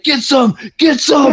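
rally yourself while mowing down bugs; battle cry. Retold or imitated. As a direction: soldier hyped while holding the nuke. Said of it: "he's just fucking like".

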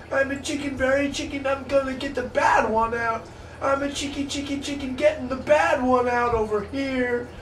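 very high squawky chicken voice, chanting. Pretend. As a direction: cluck it out like a frantic chicken, way up high. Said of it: "do a little chicken stuff".

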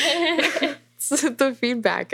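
slow words broken by a laugh. Real, not acted. Laughing at own remark.

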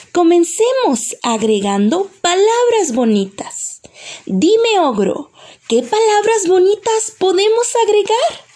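high, lilting fairy voice; encouraging fairy. Acted. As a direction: coax the ogre along as a high-voiced fairy. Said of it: "Y el hada dice". (hada animosa, voz aguda y cantarina)

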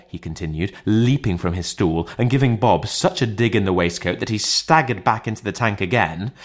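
usual speaking voice

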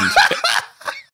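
high airless wheeze of laughter; breathless laugh. Real, not acted. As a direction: gasp out a squealing laugh. Laughter breaks in.

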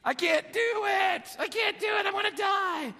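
high, shouted panic, slowed down; panicked child yelling he can't; terrified kid. Retold or imitated. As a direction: scream it like a boy scared stiff on a roof. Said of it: "And guess what I said?".

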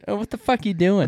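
high-pitched mock Boston accent. Co-host joining in the impression of Dave, Boston accent. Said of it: "Yeah, there he is".

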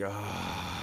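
long voiced sigh on the exhale; exhaling sigh. Shown as a demonstration. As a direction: breathe out through a drawn-out "ah". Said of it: "a little example for you".

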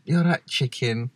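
slow, unconvincing go at Mancunian; attempted Mancunian accent. Copying a friend's greeting, in a shaky attempt at Mancunian accent. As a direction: echo it in a badly faked Mancunian accent. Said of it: "can't even do in a Mancunian accent".

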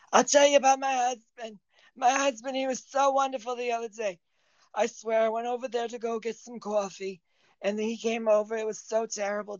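put-on high chatty old lady; little old woman voice. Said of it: "the little old woman one".

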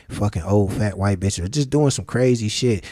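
ordinary talk

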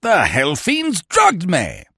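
high, drawn-out cry of outrage; furious high-pitched outburst; wrathful shout. A narrator's reading. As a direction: erupt in pent-up wrath, stretching the words. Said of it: "he shouted".

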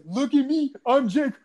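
high-pitched mock impersonation; mocking mimicry. Teacher imitating his pupil to belittle him, mocking. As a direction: put on a high, jeering teacher's taunt.

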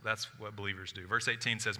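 ordinary talk, nothing marked

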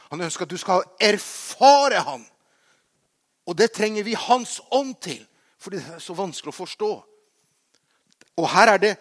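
loud, high preacher's delivery; impassioned preaching. Real, not acted. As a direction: preach it with force, punching the key words. Preaches with rising force.

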